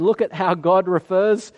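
usual speaking voice

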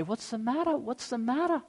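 put-on soft, high gentle asking to a crying child; tender soothing. Father to his crying daughter, softly soothing. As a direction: hush it softly, like comforting a small girl.

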